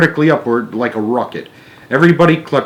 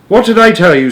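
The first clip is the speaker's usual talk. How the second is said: high, loud grumbling grandma; cranky old woman; 9 semitones higher, 7 dB louder than usual. A narrator's reading. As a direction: snap it like a grouchy old lady. Old lady voice.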